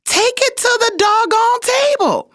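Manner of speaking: very high, sustained mock yell; emphatic coach outburst. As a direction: imitate a coach hollering a pep talk. Coach's pep talk climax, retold.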